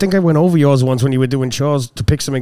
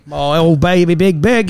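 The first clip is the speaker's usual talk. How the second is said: slow, drawn-out Australian parody; mock Australian accent; 2 semitones higher, slower than usual. Shown as a demonstration. Host echoes the baby-name joke, slow mock Australian accent. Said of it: "Australian accent".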